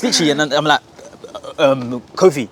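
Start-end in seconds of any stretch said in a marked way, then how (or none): 1.6-2.5 hesitant, stammered introduction; stall, then get the name out; nervous stutter. Retold or imitated. Student nervously giving a new name, mildly imitated stammer. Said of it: "I'm quivering at the lips".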